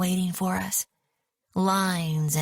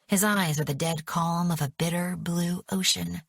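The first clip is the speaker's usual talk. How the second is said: ordinary delivery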